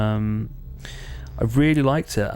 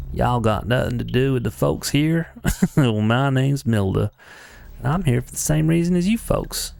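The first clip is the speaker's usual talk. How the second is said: put-on Texan accent; Texan twang, with a laugh slipping in; Milda; 2 semitones higher than usual. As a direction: play it as a folksy Texan. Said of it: "I'm doing a Texan accent".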